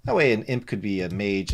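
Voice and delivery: ordinary talk, nothing marked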